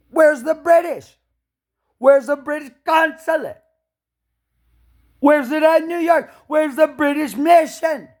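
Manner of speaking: high, loud repeated yelling; furious shouted demands; angry shouting. Real, not acted. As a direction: bellow the question at the world.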